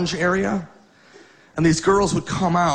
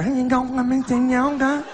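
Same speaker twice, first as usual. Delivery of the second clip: high-pitched imitation Chinese gibberish; high, sustained mock-Chinese murmur; 7 semitones higher than usual. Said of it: "it was Chinese".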